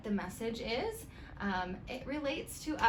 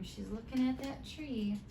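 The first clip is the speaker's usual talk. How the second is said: ordinary delivery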